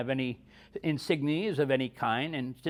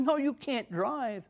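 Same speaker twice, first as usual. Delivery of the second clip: much higher, slow disbelieving no; shocked doctor; 10 semitones higher, slower than usual. Retold or imitated. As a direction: stretch it out in disbelief.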